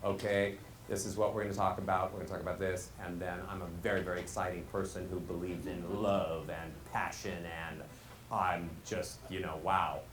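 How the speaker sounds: low, soft one-note drone; monotone. Acted. Coach imitates a recorded speaker on one key, monotone. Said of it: "as if we were a broken down piano with one key".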